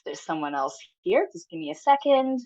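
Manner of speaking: ordinary talk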